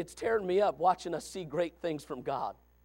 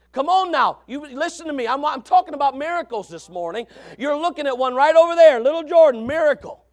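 loud, raised pulpit voice; emphatic preaching; 8 semitones higher, 11 dB louder than usual. Real, not acted. Preacher pointing congregation to a miracle child, emphatic preaching. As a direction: press the point home, building in volume.

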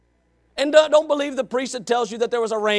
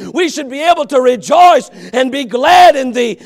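loud, high, driving exhortation; fiery preaching; 4 semitones higher, 7 dB louder than usual. Real, not acted. Preacher urging the saved congregation to get excited. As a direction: whip the church up, full voice.

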